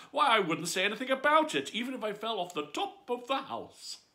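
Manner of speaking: high and loud, bubbling with laughter; giggling Alice boasting to herself; bright laughing voice. A narrator's reading.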